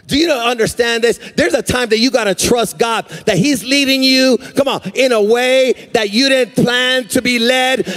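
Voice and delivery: rising, sustained sermon cadence; full-force preaching. Real, not acted. Preacher urges church to trust God, full-force preaching.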